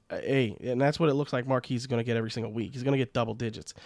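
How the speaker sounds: ordinary delivery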